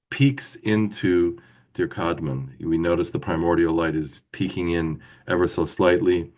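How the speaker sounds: ordinary delivery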